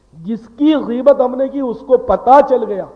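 ordinary delivery

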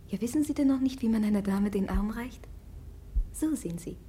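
low unvoiced teasing whisper. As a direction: whisper it close to his ear, mocking. Teasing the prince privately.

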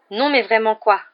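loud, irritated retort; bad-tempered. A narrator's reading. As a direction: snap back crossly. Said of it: "dit Ron avec mauvaise humeur".

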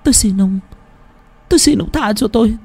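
high, tearful begging; a frightened girl begging the old man to spare her; imploring. Acted. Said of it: "Cô gái vẫn cứ khẩn khoản".

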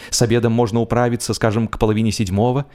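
ordinary delivery

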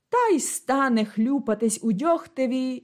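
usual speaking voice

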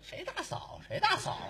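high startled retort; mock outraged who's sister; indignant surprise. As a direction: snap back in shock. Reacts to being called sister.

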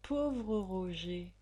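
slow, lightly voiced courtesan; pitying woman's voice. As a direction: sigh his name with pity.

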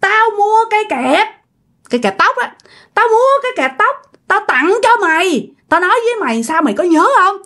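high-pitched, gloating ghost confession; acted spirit's menacing reveal; vengeful taunting. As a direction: taunt her with your secret curse, pitch soaring. Spirit reveals hairclip haunting.

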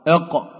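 "إق" said with a hard g-like qaf; wrong qaf. Shown as a demonstration. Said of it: "هناك من ينطقها إق، خطأ". (نطق خاطئ للقاف في "إق")

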